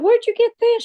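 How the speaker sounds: loud, very high-pitched incredulous question; astonished. Retold or imitated. Passenger handed whiskey on the plane, high astonished squeal. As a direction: squeal it in disbelief. Retold amazement at the drink.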